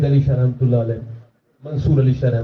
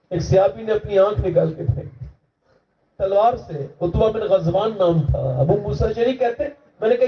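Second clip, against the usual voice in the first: raised, fervent sermon delivery; higher, fervent storytelling voice; preaching; 6 semitones higher than usual. Acted. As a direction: tell it like a preacher stirring the crowd.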